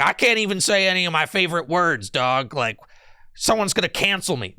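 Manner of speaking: high-pitched mock grievance; mock alt-right complainer. Streamer parodying a chatter afraid of cancelling, mock outrage. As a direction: play up the aggrieved alt-right whiner. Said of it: "That's what you sound like".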